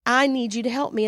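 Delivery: ordinary talk, nothing marked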